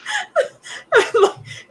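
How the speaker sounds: high burst of laughter. Real, not acted. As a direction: crack up laughing. GM laughing at players.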